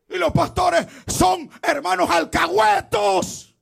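high, loud exhortation; fiery preaching. Acted. Preacher exhorting pastors not to keep silent, fiery shouting. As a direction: call out the preachers with force.

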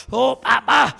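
ordinary delivery

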